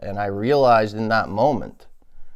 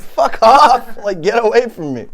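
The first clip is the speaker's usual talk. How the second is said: higher, louder mock brush-off; mock snapping; 11 semitones higher, 8 dB louder than usual. Telling clingy people off, hammed-up shout. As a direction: bark them away, half joking. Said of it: "I'm like".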